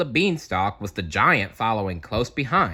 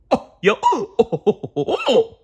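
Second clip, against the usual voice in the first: excited cartoon cheer; 6 dB louder than usual. Characters celebrating.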